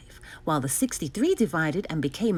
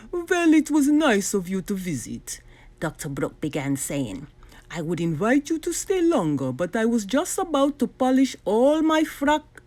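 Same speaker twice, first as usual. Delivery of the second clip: a hint of a hurried villain brushing him off; 3 semitones higher than usual. Doctor Brock tries to get rid of the hero, lightly voiced. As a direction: hustle the guest out politely.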